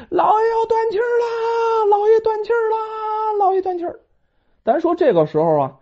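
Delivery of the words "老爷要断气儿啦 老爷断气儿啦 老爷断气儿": 又高又尖的惊慌喊叫; 边跑边扯着嗓子报信; 边跑边喊. Acted. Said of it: "一边跑一边喊". (张三边跑边惊慌大喊)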